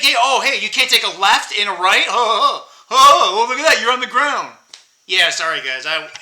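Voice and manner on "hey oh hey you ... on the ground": louder, higher mocking gloat over a fallen man; mock gloating, hammed up; gloating taunt. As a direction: rub it in with a sneer. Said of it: "It's like".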